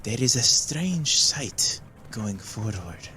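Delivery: very slow, measured seer voice; slow portentous palm reading; slow mystic prophecy. Acted. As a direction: intone it like a fortune. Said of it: "and goes".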